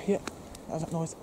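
ordinary talk, nothing marked

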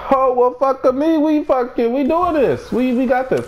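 high-pitched, eager burst; excited hype. Real, not acted. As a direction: pump yourself up in a high voice. Player excited at what he found.

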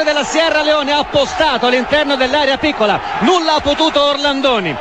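high, shouted goal call; excited goal call. Real, not acted. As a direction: yell the header goal in. Header goal called live.

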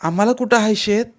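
peevish, high-pitched comeback; huffy retort. A narrator's reading. Girl retorts about having no field, huffy. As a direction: toss it back in a huff. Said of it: "फणकारानंच बोलली ती".

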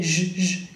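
"j" as a sustained voiced zh; French J sound. Shown as a demonstration. Teacher isolating the G of neige, held zh. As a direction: hold "j" as in je m'appelle. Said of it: "como je m'appelle".

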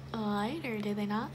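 lip held down while talking; talking with lip pulled open. Real, not acted. Seen 4: Fingers pull lower lip down to show teeth, then rest on chin.

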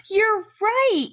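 gasp, then a high, loud you're right; delighted surprise, pitch jumps up. Acted. Gasps at correct answer.

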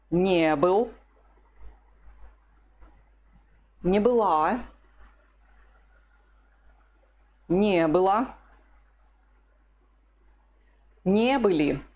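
Не был, Не было, Не были with the stress on не and Не была stressed on была; stress on the particle. Shown as a demonstration. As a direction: lean on не in each form except была. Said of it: "the stress becomes very important here".

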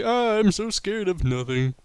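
high, mocking fake fright; mock scared. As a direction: sneer it like a fake scaredy-cat. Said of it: "I'm just like".

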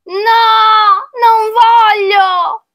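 high, loud, drawn-out wail; child's refusal. Acted. Child refusing to become a snail, loud high wail. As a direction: cry out high like a child digging in.

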